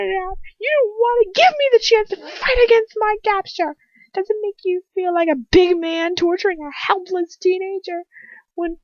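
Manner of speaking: very high, loud sobbing scream; screaming, crying. A narrator's reading. Girl screams and sobs coward at her captor. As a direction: break down screaming the words. Heroine screams through tears.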